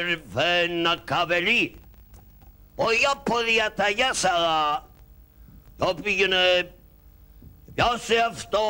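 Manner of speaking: pompous pasha in broken Greek; Turkish pasha. Acted. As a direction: pasha speaking with Turkish accent.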